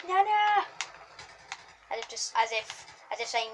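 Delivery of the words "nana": high, loud soldier's call; imitated game soldier. Gamer copies what the Russian soldier yelled, imitated call. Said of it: "It sounded like he just said".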